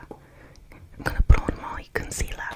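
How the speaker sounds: whispered Australian accent impression; a playful Aussie accent, half whispered. Shown as a demonstration. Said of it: "accent for an Aussie accent?".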